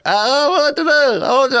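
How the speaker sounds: put-on high, flustered voice of a helpless older woman; panicked mother-in-law. Panicked mother-in-law, car damaged.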